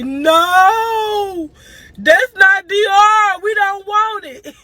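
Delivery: put-on high, loud child's voice protesting; kid protesting. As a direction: play the little boy refusing hard. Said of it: "Bubba was like".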